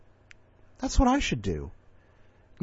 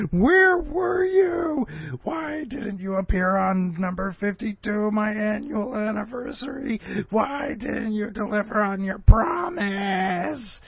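high, slow, whining complaint with a fake sob; whiny Jeffrey; 9 semitones higher, slower than usual. Host imagines Jeffrey's recorded complaint, high tearful whine. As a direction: whimper like a jilted friend. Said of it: "he's gonna have a recording in here saying".

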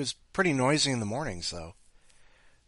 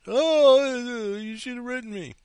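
mock sender voice; 12 semitones higher, 7 dB louder, slower than usual. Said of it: "And it's like".

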